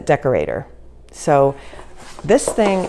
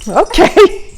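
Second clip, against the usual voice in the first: nervous okay; 9 dB louder than usual. Real, not acted. Testing hot oil, bracing.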